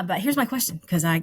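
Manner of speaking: ordinary talk, nothing marked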